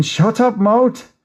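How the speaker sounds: sudden high yelp at his own mouth; telling himself off. Acted. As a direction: snap up high to shut yourself up. Scolding himself mid-giggle.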